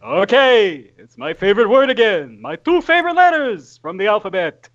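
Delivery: lightly voiced stand-up comic lilt; Jerry Seinfeld. Player riffing on the word okay, Seinfeld-like lilt. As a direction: stretch and lift it like a comic's bit. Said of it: "Now you're Jerry Seinfeld".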